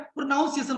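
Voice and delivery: "प्रोनाउंसिएशन" with NOUN in the middle; "प्रोनाउंसिएशन" with an ow vowel after n; common mispronunciation. Shown as a demonstration. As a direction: say "प्रोनाउंसिएशन" as pro-NAUN-si-ay-shun. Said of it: "अब इसको कोई बोलता है".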